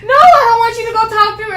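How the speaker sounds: high, loud upset refusal; upset girl telling the officer no. Retold or imitated. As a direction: snap it back at the officer.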